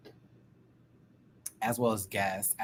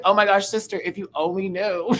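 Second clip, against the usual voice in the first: emotional; 8 semitones higher, 5 dB louder than usual. Retold or imitated. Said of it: "I'm getting emotional".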